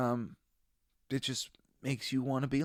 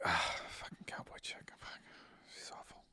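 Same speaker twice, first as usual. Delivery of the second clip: hushed muttered disgust; disgusted whisper; 3 semitones lower, 12 dB quieter than usual. Retold or imitated. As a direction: whisper your disgust at her. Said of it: "it was just sort of like".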